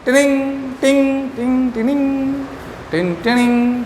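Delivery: soft repeated "tinning, टिंग" chime imitation; fridge chime sound. Shown as a demonstration. Teacher to students, imitating how a fridge sounds. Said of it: "आवाज़ कैसे करता है fridge".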